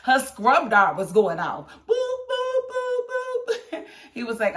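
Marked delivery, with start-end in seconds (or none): 1.9-3.8 high, steady mock beeping; pager beeping. Fan imitates a pager beeping at a TV scene.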